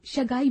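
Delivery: शगाई, sh instead of s; mispronounced sagai. Shown as a demonstration. Teacher showing a common mistake, शगाई with sh. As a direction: start शगाई with a sh sound. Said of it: "अशुद्ध शब्द बोलते हैं".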